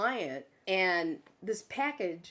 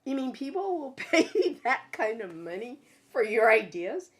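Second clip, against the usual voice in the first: high, loud, laughing disbelief; retold astonishment, pitched way up; amazed question; 8 semitones higher, 7 dB louder than usual. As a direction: ask it incredulously through laughter. Retells amazed question to boss.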